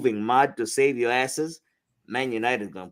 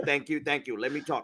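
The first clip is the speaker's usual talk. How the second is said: ordinary talk, nothing marked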